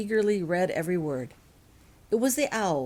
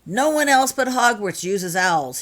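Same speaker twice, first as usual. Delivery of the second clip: louder insistent man's voice; Dylan assuring; 4 semitones higher, 8 dB louder than usual. A narrator's reading. Dylan assures Laurel, louder and insistent. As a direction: insist firmly. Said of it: "he assured Laurel".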